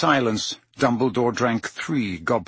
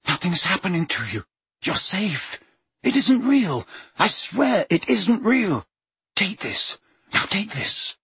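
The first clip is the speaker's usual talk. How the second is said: desperate soothing; 8 semitones higher than usual. Acted. Harry pleads, soothing desperately.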